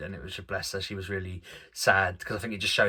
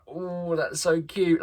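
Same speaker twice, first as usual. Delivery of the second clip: high, slow, doting oh that's so cute; touched; 8 semitones higher, much slower than usual. Retold or imitated. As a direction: melt at the cute pictures. Watching sweet slideshow.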